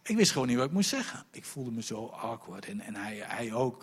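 ordinary talk

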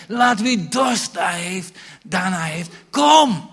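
slow, high, loud invitation; calling out. Acted. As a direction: call them to come. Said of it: "En hij zegt". (luid uitnodigend roepen)

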